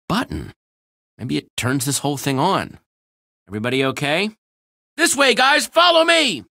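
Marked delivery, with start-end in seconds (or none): 5.0-6.4 shout. Acted. Leading group in escape.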